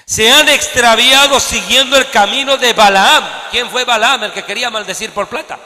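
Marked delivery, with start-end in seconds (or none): 0.0-3.2 slow, loud scripture reading; lectura enfática. Acted. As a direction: read the verse out with weight. Reads scripture with force. (lectura bíblica lenta y fuerte)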